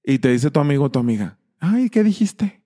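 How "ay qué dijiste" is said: high, slow, puzzled friend voice; drawn-out dumb question, pitched way up; mock clueless friend. Friend who never watched the video asks, high and slow.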